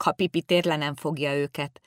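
ordinary delivery

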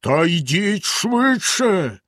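slow, high bellow in a strange voice. A narrator's reading. Bluebeard bellows again at his wife, slowly. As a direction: roar it out again. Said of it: "знову заволав Синя Борода".

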